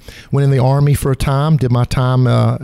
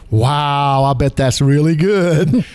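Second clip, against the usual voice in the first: imitating people; much slower than usual. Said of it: "most people look at those and go".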